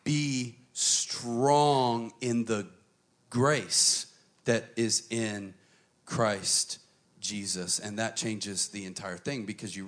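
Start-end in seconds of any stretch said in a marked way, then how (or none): 0.0-7.8 very slow, weighted words, stress on grace; slow deliberate reading. Shown as a demonstration. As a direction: let each word of the verse land. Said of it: "Watch this".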